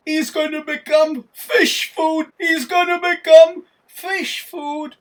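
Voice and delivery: high, very loud hollering from the boat; alarmed shout. A narrator's reading. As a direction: shout it out, stretching the repeat. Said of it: "Somebody in the boat shouts".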